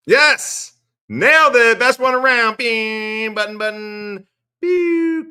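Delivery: very high, loud victory yells; triumphant cheer. Real, not acted. Cheering himself after his Mersh impression, very loud. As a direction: celebrate like you just won.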